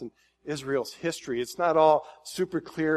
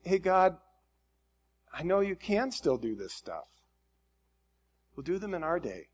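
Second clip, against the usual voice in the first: higher-pitched, earnest appeal; pleading; 5 semitones higher than usual. Acted. Preacher voicing the prophet's prayer to God, pleading. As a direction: plead upward, falling away at the ends. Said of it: "now he's pleading. He's like".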